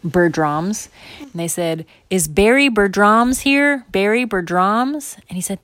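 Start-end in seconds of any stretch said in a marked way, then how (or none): 2.1-5.2 slow, loud, raised call; announcer calling out. Acted. Zoo volunteer seeking drawing winner, calling out. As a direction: call the name across a crowd.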